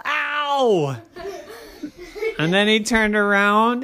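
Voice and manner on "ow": long high comic "ow"; cry of pain. A narrator's reading. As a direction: yelp as if walking into a wall. Daddy hits a wall.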